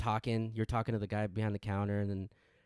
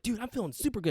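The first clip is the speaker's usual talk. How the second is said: high-pitched hyped reply; imitating buzzed friend; 11 semitones higher, faster than usual. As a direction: act out a wired buddy answering. Friend's retold caffeine high.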